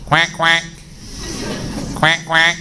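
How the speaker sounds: short mock quacks, like a duck heard in the park; duck quacking. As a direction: imitate a duck's double quack. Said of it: "they heard a sound".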